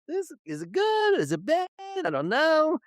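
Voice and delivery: high-pitched, wavering back and forth; acted uncertainty. Host mimicking mixed reaction to the news, torn and unsure. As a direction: voice the dithering, pitched well up.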